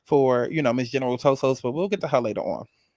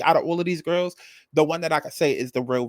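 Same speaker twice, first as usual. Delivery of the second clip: usual speaking voice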